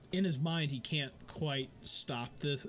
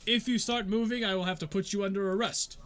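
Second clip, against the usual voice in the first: high, louder official warning; stern guard warning; 8 semitones higher, 6 dB louder than usual. Acted. Guard threatening arrest, stern. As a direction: warn them firmly.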